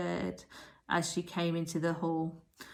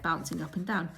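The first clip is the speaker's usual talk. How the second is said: ordinary delivery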